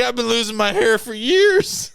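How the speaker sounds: high, loud wail as a Muppet-like friend; Muppety voice. Retold or imitated. Imitating friend complaining about losing hair, Muppety. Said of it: "like, a Muppety voice".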